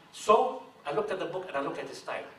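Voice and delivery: ordinary talk, nothing marked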